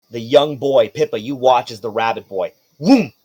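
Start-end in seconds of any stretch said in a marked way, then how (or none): 2.8-3.1 loud, high vocal swoosh; whooshing "whoom". Acted. Game master showing the rabbit boy dodging a fist, loud whoosh. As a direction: voice the sound of a quick dodge. Imitated noise of a dodge.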